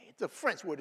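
ordinary talk, nothing marked

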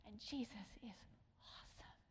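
hushed, breathy whisper; awed whisper. Real, not acted. Preacher whispering to the congregation in wonder. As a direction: breathe it out in awe. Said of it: "whispered awe".

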